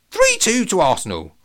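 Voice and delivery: high, loud squawking parrot. Acted. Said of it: "squawked Jabba".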